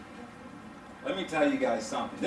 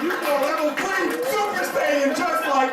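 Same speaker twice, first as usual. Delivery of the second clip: powering up; 11 semitones higher than usual. Pretend. Powering up like Super Saiyan.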